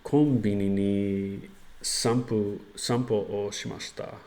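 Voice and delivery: slow, careful learner Japanese; japanese learner accent. Shown as a demonstration. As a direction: sound out each Japanese word carefully. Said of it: "I think I can say".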